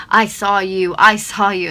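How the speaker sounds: imitating a shout. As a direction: mimic her yelling at you. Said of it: "and just screaming at me".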